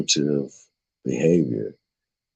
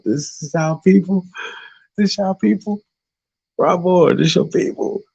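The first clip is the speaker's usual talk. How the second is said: laughing tease; 13 semitones higher than usual. Real, not acted. Teasing the chat, laughing.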